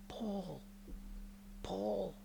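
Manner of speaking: hushed high call of Paul; faint calling of the name; soft distant calling. Retold or imitated. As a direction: call the name gently from far away. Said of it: "is calling out to me".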